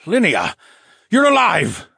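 high, loud cry of relief; gasping joy. Acted. Duke Phineas to the waking mermaid, gasping with joy. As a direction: burst out as he rushes to the bed. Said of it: "Phineas gasped".

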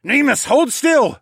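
high, loud, slowed command; urgent shout. Acted. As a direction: shout a command mid-struggle. Urgent command while cutting ropes.

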